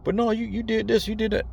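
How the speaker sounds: mock screaming guy. Vlogger mimicking someone screaming at him, high mock yell. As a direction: squeak out a ranting guy's nagging, hammed-up. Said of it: "like, screaming at you".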